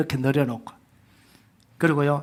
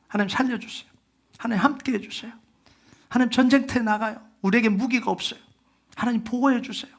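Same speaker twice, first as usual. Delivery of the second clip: higher, pleading cry to God; desperate plea; 7 semitones higher than usual. Retold or imitated. Choir marching to war begging God, desperate plea. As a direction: cry out like facing death unarmed. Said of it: "그렇게 부르짖으면서 나가지 않겠어요".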